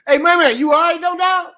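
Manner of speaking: loud high shout to a sleeper; calling out. Retold or imitated. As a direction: rouse the sleeping driver.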